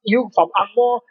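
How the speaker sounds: mock angry Singlish rant; imitating a rude local troll, high. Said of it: "they're like".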